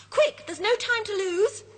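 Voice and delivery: high, urgent warning cry; alarmed cry. Acted. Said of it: "he cries".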